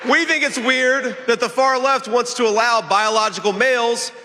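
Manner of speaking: high, projected stump-speech delivery; rally speech. Real, not acted. Candidate attacks the opposing party before a crowd, rally speech. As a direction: address the crowd in measured, emphatic phrases.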